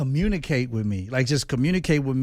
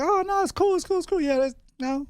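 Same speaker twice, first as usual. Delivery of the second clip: very high breezy reassurance; mock casual relative; 12 semitones higher than usual. Husband imitating his family after a fight. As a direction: toss it off in a squeaky carefree voice. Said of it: "We be like".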